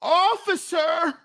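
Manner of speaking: high, drawn-out appeal to a policeman; hammed-up submissive greeting; mock deference. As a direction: call the officer in a high, pleading voice.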